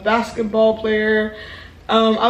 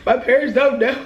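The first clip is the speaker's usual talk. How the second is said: mock-crying plea with a laugh in it; hammed-up whimpering plea; tearful begging. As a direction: beg as if blubbering, played for laughs. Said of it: "I'm crying, I'm like".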